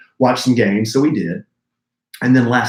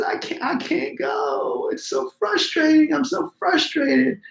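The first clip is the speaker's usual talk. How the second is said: high, drawn-out whine through mock tears; tearful, high-pitched mock complaint; imitated crying child; 3 dB quieter, slower than usual. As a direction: whimper it slowly like a distressed kid. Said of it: "he starts crying".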